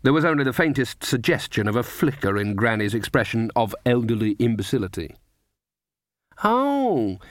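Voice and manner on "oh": put-on high, slow doddering old lady; a sweet simple old woman act; frail old woman. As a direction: feign a harmless dotty crone. Granny plays a bent old woman.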